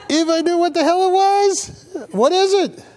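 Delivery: high, baffled question. Real, not acted. Baffled by strange food.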